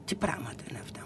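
imitated scandalised whisper; hushed indignation. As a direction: whisper it in mock affront. Retold indignant refusal to write.

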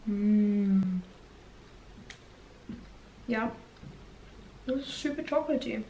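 talking while chewing food; muffled mid-bite speech; mouth full, chewing. Real, not acted. Seen 0: Bites brownie at -3s, lips closed chewing, bites again at 4.5s.

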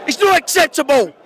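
loud, high, furious yell; shouting in real anger at the club. Real, not acted. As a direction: rage at the team's failure. Furious fan rant after defeat.

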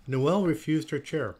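usual speaking voice

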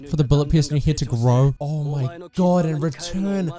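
ordinary talk, nothing marked

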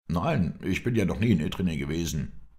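ordinary delivery

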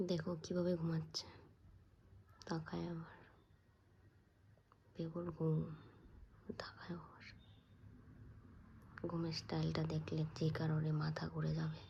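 soft unvoiced whisper. Real, not acted. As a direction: whisper so nobody wakes. Not waking a sleeping person.

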